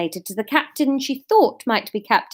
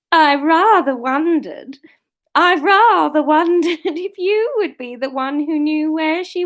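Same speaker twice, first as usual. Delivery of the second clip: character voice; 7 semitones higher, 5 dB louder than usual. Acted. Asmar in upper voice.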